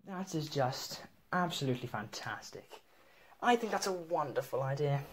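host a tea party in upper-class English; hammed-up posh voice; posh accent. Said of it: "Aristocratic. That means posh".

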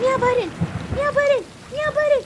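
high, loud, punchy exclamation; loud high-pitched squeal of delight; excited shouting. Real, not acted. Calling out game meow button.

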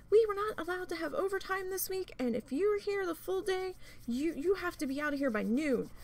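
imitating a seething manager, high-pitched; high, scolding mock scream; retold screaming tirade. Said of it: "screaming at me".

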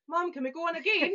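put-on high, loud kid's plea; imitated eager child. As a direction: mimic a child begging mum excitedly. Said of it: "She's like".

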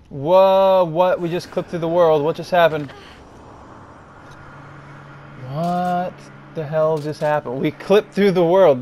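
loud, high disbelief; can't believe what just happened; astonished. Real, not acted. Fell through game world.

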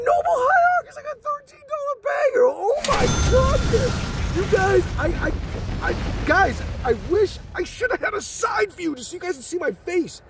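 very high, giddy outburst; breathless thrill, stammering in disbelief; real overexcited. Real, not acted. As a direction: squeal with joy, tripping over the words. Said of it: "it's red with excitement".

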